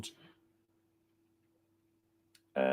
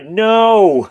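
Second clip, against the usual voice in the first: loud no; 9 semitones higher, 13 dB louder than usual. Real, not acted. Rejecting a wrong viewer guess.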